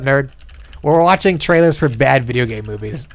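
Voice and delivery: ordinary talk, nothing marked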